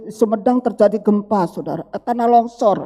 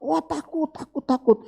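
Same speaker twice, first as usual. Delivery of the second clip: high, softer imitation of being scared; mock fear; 5 semitones higher, 4 dB quieter than usual. Reacting to frightening news, mock fearful. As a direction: act lightly frightened. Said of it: "berita-berita menakutkan".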